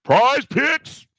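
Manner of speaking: high, loud sponsor shout; hype call. Acted. Calling out sponsor.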